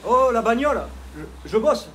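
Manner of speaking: indignant shout. Real, not acted. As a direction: yell at a passing car that interrupts your work. Interrupted by a passing car. (cri indigné)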